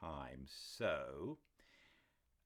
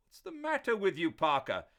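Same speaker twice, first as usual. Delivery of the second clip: loud, much higher exasperation; exasperated outburst; 10 semitones higher, 12 dB louder than usual. A narrator's reading. Doctor exasperated with a staring Parker. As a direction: snap at the dazed butler.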